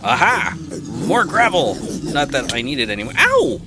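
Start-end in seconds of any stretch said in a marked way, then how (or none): 3.2-3.6 sharp high yelp of pain; yelp, much higher and louder; sudden ow. Real, not acted. Gamer yelping as a mob hits her, high yelp. Gets hit in the game.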